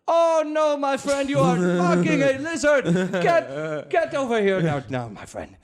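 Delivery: yelling at the men to get over here; hammed-up Indian accent outrage; Indian guard shouting. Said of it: "the guy who caught him be like".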